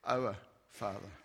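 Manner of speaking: low, soft, reverent Our Father; reverent prayer. Shown as a demonstration. Jesus beginning the Lord's Prayer, low and reverent. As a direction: pray it quietly, with awe. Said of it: "So when you pray, say".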